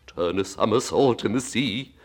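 ordinary delivery

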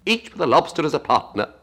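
crying out. A narrator's reading. Gryphon adds a dance step, crying out quickly. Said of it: "cried the Gryphon".

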